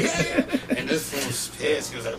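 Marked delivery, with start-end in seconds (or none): 0.0-0.8 laughing. Retold or imitated. Laughing reply.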